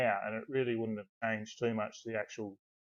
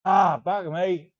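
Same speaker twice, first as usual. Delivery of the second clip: slow, loud, higher mock curse; exasperated swearing; 6 semitones higher, 6 dB louder, slower than usual. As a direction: draw the curse out loudly, imitating. Retells narrator swearing.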